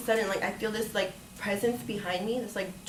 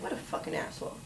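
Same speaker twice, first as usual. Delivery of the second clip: bitter mutter; 6 semitones lower, 9 dB quieter than usual. Retold or imitated. Muttered inner insult.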